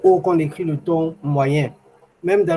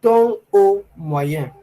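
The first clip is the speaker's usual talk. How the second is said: slow demo; 3 semitones higher, much slower than usual. Shown as a demonstration. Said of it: "retenir la mélodie".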